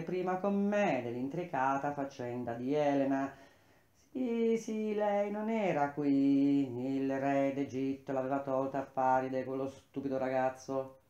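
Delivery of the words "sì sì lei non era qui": high-pitched, drawn-out admission; impatient concession. A narrator's reading. Admitting Helen was not here, impatient concession. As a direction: concede it slowly, starting high.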